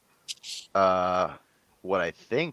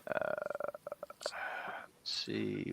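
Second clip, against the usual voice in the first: normal talking voice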